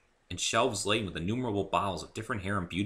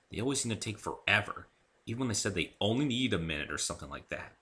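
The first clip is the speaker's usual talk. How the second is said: ordinary delivery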